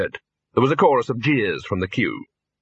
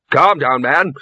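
loud, firm command; sharp order to calm down; 2 semitones higher, 5 dB louder, slower than usual. A narrator's reading. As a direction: pull a panicking colleague up short. Arch-Chancellor checks panicking bursar.

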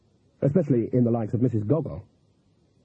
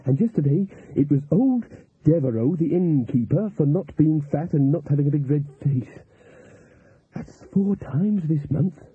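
raised character voice of a cook; cook reporting news; 3 semitones higher than usual. Acted. Cook telling the tent about recent arrests, in character. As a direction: pass on the bad news, pitched a little up.

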